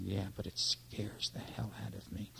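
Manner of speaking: soft voiceless admission; frightened whisper to a friend; whispered confession. Retold or imitated. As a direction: whisper it like a secret fear. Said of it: "decided to be honest".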